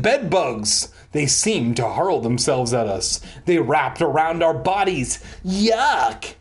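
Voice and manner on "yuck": higher-pitched disgusted yelp; crying out. A narrator's reading. Narrator wrapped in smelly blankets, crying out. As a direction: pop it out in mock disgust. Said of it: "I cried out".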